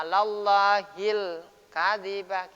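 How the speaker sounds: alallahil said slowly with a clear lam; correct recitation. Shown as a demonstration. Teacher showing correct recitation of alallahil. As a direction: draw out alallahil carefully. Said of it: "gitu caranya".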